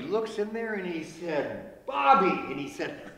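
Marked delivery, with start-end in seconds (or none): 1.9-2.3 loud, higher shout of the name; calling out. Acted. Dad catching his son with grandma, shouting his name. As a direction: call the boy's name sharply.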